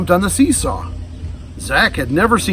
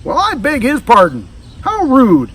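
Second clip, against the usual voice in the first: offended; 9 semitones higher, 3 dB louder than usual. A narrator's reading. Offended gator scolds policeman.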